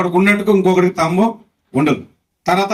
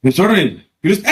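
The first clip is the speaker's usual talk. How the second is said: ordinary talk, nothing marked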